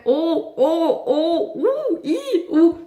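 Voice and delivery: high, jittery whoa sounds to a skittish horse; nervous horse-calming. Retold or imitated. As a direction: panicky little oh-oh-oh calls. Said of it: "tout le long, j'étais là".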